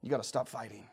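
ordinary talk, nothing marked

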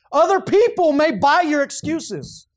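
high, loud, slow preaching cadence; full-force preaching. Real, not acted. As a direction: preach it out to the room.

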